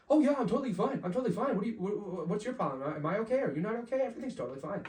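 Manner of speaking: overcaffeinated and talking too much; wired kid insisting to the monitor he's fine; wired denial. Retold or imitated. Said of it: "And I'm like".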